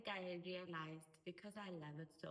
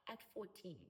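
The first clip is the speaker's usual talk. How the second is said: ordinary talk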